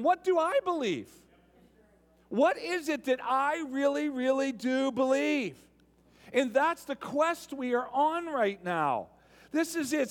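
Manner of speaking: loud, driving sermon delivery; full-force pulpit voice; preaching. Acted. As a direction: preach it to the whole room.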